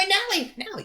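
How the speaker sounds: squeaky mock child calling out; twelve-year-old kid. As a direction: imitate a kid yelling for someone's attention. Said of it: "this little fucking twelve-year-old".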